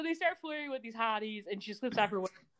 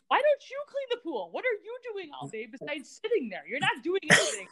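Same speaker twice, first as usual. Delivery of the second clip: higher-pitched exasperated nagging; pitched-up, indignant telling-off; mock scolding; 7 semitones higher than usual. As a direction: scold them in mock outrage.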